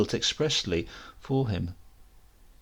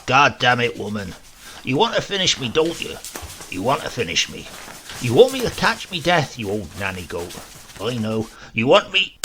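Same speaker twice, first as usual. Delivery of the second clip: put-on louder, indignant outburst from an old man, words hurled at the housekeeper; blasphemous protest; 4 dB louder than usual. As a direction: shout your protest at her like a cornered old rogue. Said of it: "his blasphemous protestations".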